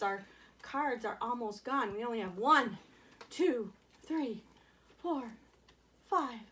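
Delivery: ordinary talk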